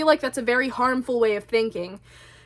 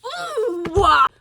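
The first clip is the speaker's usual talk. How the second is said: mock frustrated outburst; 7 semitones higher than usual. Petty person who can't be right, mock frustrated outburst. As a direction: wind up, then explode in mock frustration. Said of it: "That's how I am. I'm like".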